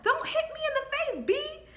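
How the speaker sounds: high put-on pleading voice; mocking Georgia. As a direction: mimic her whining plea. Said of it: "So Georgia was like".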